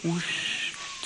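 quiet whoosh. Shown as a demonstration. Leading the wind blowers in, quiet breathy whoosh. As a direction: breathe it like a faint breeze. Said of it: "Just nice and quiet".

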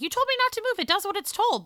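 mock-indignant quip to the doctors; sassy retort.

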